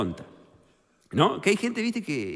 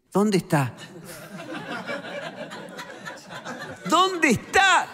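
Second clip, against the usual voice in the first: the second "¿Dónde está?" goes much higher and slow; mock caller voice; 12 semitones higher, much slower than usual. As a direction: stretch the repeated question into a squeaky whine. Imita al que llama al fijo. (imitando al que llama, voz aguda y lenta)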